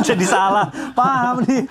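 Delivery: giggling at her own mix-up; laughing. Real, not acted. Host laughing off the in-law confusion, laughing.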